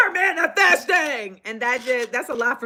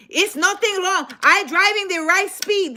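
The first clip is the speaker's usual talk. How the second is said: imitating driver; 8 semitones higher than usual. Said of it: "driver's reply voiced high".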